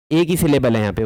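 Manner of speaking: ordinary talk, nothing marked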